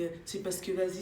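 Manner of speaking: normal talking voice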